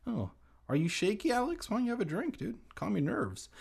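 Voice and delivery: high, quick teasing voice; mock coddling. Reader teasing shaky Alex, high mock concern. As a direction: tease in a high baby-talk lilt.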